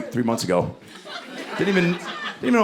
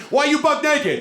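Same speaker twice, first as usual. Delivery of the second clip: tough guy question; 14 semitones higher than usual. Retold or imitated. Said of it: "What about the no clothes thing".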